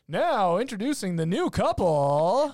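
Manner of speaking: loud, high announcer voice booming the entrance; hammed-up emcee introducing the couple; wedding MC announcing. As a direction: call it out like the wedding DJ on the mic. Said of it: "it's the person who's like".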